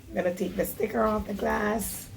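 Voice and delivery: usual speaking voice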